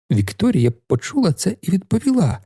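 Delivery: plain reading voice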